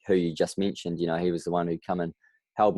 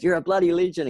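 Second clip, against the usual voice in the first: much higher and louder, bursting with joy; excited praise; 11 semitones higher, 5 dB louder than usual. Retold or imitated. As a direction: shout the praise like a proud big mate. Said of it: "he goes".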